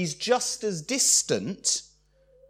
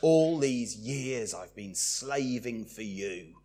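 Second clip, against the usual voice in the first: slow, softer worn-out complaint; weary; 5 semitones lower, 4 dB quieter, slower than usual. Acted. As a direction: sigh it like a tired slave. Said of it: "wearily put up with it".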